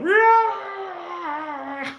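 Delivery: high shouted battle cry; crazy yell. Retold or imitated. Fan imitating Murdoch's high yell in serious time. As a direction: bark a loud high war cry.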